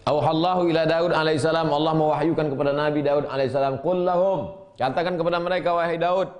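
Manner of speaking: thundering pulpit voice; full-force preaching. Acted. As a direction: proclaim it to the whole hall.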